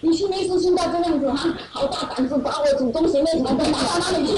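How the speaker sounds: husband's temper. Retold or imitated. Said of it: "他就大发脾气".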